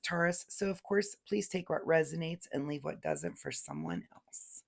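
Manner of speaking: ordinary delivery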